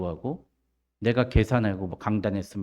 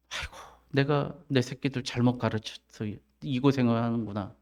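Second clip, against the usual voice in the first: parent's lament; 7 dB quieter than usual. Retold or imitated. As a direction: sigh it as a regretful parent.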